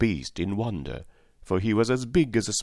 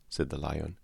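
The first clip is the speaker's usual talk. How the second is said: ordinary delivery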